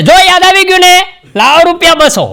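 high, loud gotcha shout; triumphant quack. Acted. Quack shouting high and loud at the fooled patient. Quack demanding money again.

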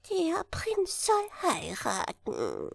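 a little chancellor's piping voice; high falsetto. Acted. Chancellor proposing marriage to the king, high falsetto. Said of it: "mit seiner hohen Fistelstimme".

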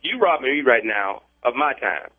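ordinary delivery

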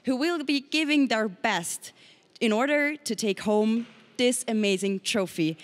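ordinary delivery

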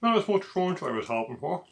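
chewing while talking; speaking between bites; mouth full of pizza. Real, not acted. Seen 0: Bit slice at -1.0; jaw moving, slice held by mouth throughout.

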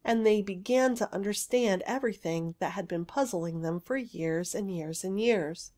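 usual speaking voice

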